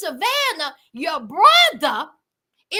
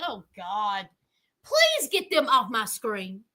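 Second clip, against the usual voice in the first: low, soft plea of exasperation; weary plea; 10 semitones lower, 4 dB quieter than usual. Retold or imitated. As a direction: beg for relief, voice dropped low. Acting out own weary plea.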